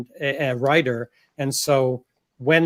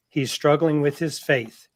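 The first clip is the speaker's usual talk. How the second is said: normal talking voice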